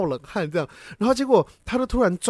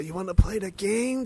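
mock horror villain; 4 dB quieter than usual. Imitating horror movie villain.

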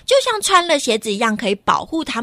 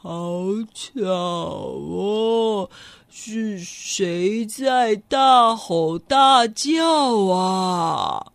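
very slow, low, sleepy grumble; a big beast woken from its nap, groaning; drowsy big animal; 3 semitones lower, much slower than usual. Acted. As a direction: woken by the lion's roaring, a huge sleepy animal grumbles slowly. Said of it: "又听到另外一个声音".

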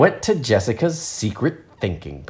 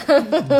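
high, sudden laugh; real giggle breaking through; laughing at her own voice; burst of laughter. Real, not acted.

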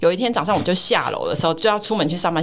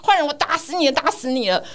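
higher, triumphant pretend-fight cries; aunt pretending to defeat her nephew, triumphant; playful mock hero; 6 semitones higher than usual. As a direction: play the hero finishing off the villain. Playing good guy beating nephew.

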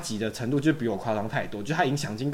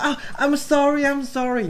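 high, mock-sweet English sorry; fake apology; 4 dB louder, much slower than usual. Rude guy mocking the girl he hit, fake sorry. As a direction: ham up an insincere apology. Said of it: "他说".

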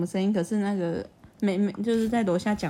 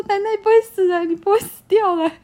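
put-on high and loud, insistent little kid; upset child protesting; 11 semitones higher, 8 dB louder than usual. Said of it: "我说".

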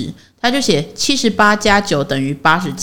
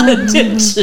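laughing; 3 semitones higher, slower than usual. Real, not acted. Laughing about persistence.

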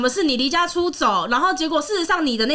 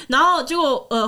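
ordinary talk

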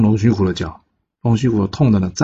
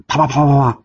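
rapid, higher-pitched smacking noises; imitated stone volley; 6 semitones higher than usual. A narrator's reading. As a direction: rattle off a burst of flying-stone sounds. (高音连串拟声)

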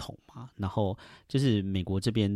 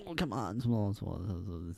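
forceful; 7 dB quieter than usual. Retold or imitated. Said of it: "很有劲道的方式".

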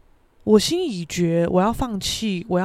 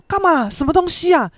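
higher, louder startled questions; very surprised; 7 semitones higher, 4 dB louder than usual. Retold or imitated. Son seeing father about to cry, startled. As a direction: ask in startled bafflement. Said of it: "我也是超惊讶".

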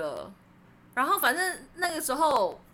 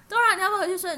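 higher and louder second ask; louder retold plea; 7 semitones higher, 5 dB louder, much faster than usual. Urging the drunk friend to bed, higher and louder. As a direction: call her name up high, half laughing. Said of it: "然后我就说".

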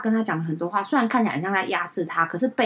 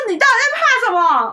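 much higher, louder incredulous cry; exasperated outburst; 8 dB louder than usual. Retold or imitated. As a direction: throw it at him in disbelief. Exasperated retort at friend, retold.

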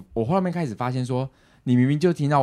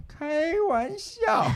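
very slow, much higher "开玩笑"; imitating dad; much slower than usual. Daughter mimicking her father's catchphrase, slow and high. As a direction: drawl it like dad's catchphrase. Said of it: "常常听到我爸讲".

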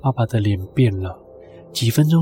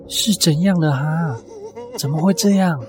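nervous question; 4 semitones higher than usual. Acted. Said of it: "紧张地问道".

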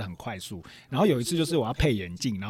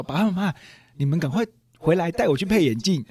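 whiny plea; 6 semitones higher, 6 dB louder than usual. Retold or imitated. Son pleads with parents by phone.

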